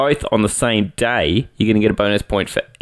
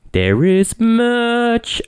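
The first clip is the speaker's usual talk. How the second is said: drawn-out hype; 13 semitones higher, much slower than usual. Acted. Hyping up the merch.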